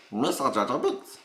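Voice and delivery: high, loud, goofy alien voice; Jar Jar Binks. Shown as a demonstration. Voice coach demonstrating an impression, high Jar Jar Binks. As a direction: pitch it right up like a goofy alien. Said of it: "Mister get blamed for ruining".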